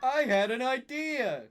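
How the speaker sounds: high, loud excited exclamation; exclaiming. Acted. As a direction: burst out with the idea. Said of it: "he exclaimed".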